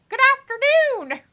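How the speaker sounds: high, loud playful call; hammed-up greeting. Host greeting the chat in daytime, hammed-up call. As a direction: sing out a big mock-cheery hello.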